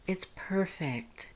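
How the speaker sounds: hushed, slow, breathy whisper; awed whisper. A narrator's reading. Dance holding the mustard plush, whispering that it's perfect. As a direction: breathe it out in wonder. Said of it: "Lust agreed".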